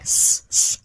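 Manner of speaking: long unvoiced hiss स, स like a snake; a drawn-out snake hiss on स; hissing s sound. Shown as a demonstration. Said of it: "स की आवाज निकलती है ना".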